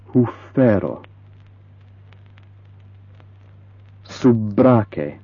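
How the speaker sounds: Sennoma, Mallumo with the doubled consonant held twice as long; long double consonants. Shown as a demonstration. Teacher showing learners long double consonants. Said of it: "twice as long".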